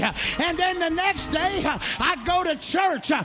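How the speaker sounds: loud, high, whooping preacher cadence; full-force preaching. Acted. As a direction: preach it out over the organ, building. Preaching rises to full force again.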